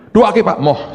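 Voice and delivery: loud high plea, tossed off fast; mock panicked shout. Man begging the pastor to pray for him, in mock panic.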